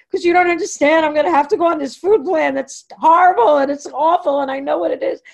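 high, loud, upset pleading; tearful outburst. Retold or imitated. To the therapist after sobbing, a high loud tearful protest. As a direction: let it spill out through tears.

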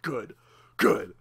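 mock deep Kratos approving. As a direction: rumble it like a satisfied war god. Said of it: "Kratos is looking down".

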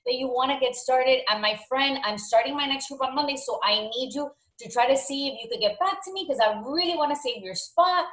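high, upbeat voicemail greeting; excited. Shown as a demonstration. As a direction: pour energy into the invite. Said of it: "It's all about energy".

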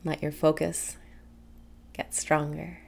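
usual speaking voice

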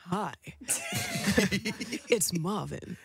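imitating a smooth-talking caller; suave. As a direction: play it dead suave, with a laugh breaking in. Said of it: "dead, like, suave".

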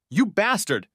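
high-pitched outraged accusation; narrator's contained female yell; restrained shout. A narrator's reading. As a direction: cry it out high without real volume. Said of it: "she shouted".

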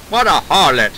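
put-on shocked old Hollywood mid-Atlantic voice; mid-Atlantic accent. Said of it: "whip out that mid-Atlantic accent".

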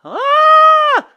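very high, loud scream; long piercing shriek of fright. A narrator's reading. Boy sees a dragon, screaming. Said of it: "screamed Radius".

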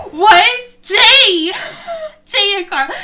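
loud, high shocked shout; shocked outcry. Real, not acted. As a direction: yell in disbelief at the screen. Shocked by sim's date wish.

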